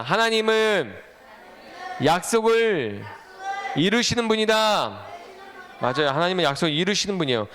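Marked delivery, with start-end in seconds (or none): 0.0-5.1 slow, drawn-out phrases with pauses between; repeat-after-me call. Shown as a demonstration. Preacher leading children to repeat the line, slow call-out. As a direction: call it out slowly for a group to echo. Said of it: "따라해 봅시다".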